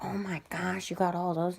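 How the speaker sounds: high-pitched imitation of an amazed grandma; excited grandma. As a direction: mimic grandma sharing the excitement. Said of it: "She's like".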